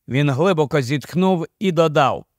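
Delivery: usual speaking voice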